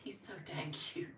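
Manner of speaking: hushed, whispered fondness; barely voiced, doting aside; adoring whisper. Real, not acted. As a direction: whisper it to yourself, smitten. Murmurs adoringly about pet.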